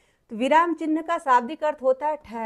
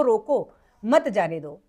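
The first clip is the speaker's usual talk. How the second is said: रोको, a break, then lower मत जाने दो; pause after रोको; 5 semitones lower, slower than usual. Shown as a demonstration. Teacher explains the second sentence, pausing after रोको. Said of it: "दूसरे वाक्य में आपको पता चल रहा है".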